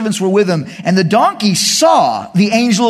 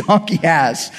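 ordinary delivery

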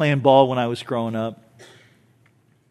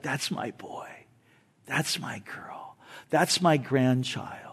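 proud parent whispering, slow and soft; fond whisper; 2 semitones lower, 9 dB quieter, slower than usual. Retold or imitated. Parent proudly watching their child, whispered. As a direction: breathe it like a doting grandparent.

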